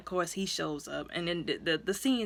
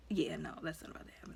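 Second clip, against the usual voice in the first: quick whispered refusal; 3 semitones lower, 10 dB quieter, faster than usual. Retold or imitated. Siblings refusing father's request.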